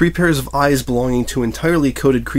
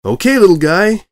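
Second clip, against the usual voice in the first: eager coaxing; 9 semitones higher, 7 dB louder than usual. Acted. Reporter coaxing excitedly.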